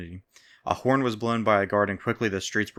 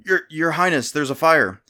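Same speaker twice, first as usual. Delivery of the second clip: out of breath; 7 semitones higher, 7 dB louder than usual. A narrator's reading. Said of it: "Out of breath, he held himself up".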